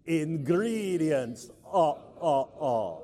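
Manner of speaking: slow, stretched word then chopped vampire laugh; the Count. Shown as a demonstration. Leading the congregation together in a count-like voice. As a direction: draw it out like a cartoon count. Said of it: "say it in a count-like voice".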